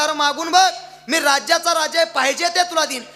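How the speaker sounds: high, emphatic royal boast; big-hearted king pressing an offer; insistent king. Acted. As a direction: urge him grandly, pitched well up. King urges woodcutter to ask.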